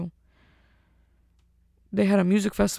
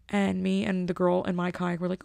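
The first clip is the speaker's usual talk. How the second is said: ordinary delivery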